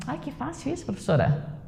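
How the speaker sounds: soft, high-starting mock student voice; imitated student. Teacher mimics a student answering too easily, soft and high. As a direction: play a student who finds it all easy.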